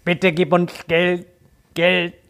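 slow, stilted fake-video voice; overdone glitchy deepfake; bad deepfake voice. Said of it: "So voll übertrieben schlecht".

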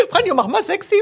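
playful hammed-up command through laughter; mock photographer call. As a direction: tease your husband with a photographer's line. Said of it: "Weißt du".

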